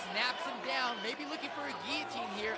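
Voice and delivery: ordinary talk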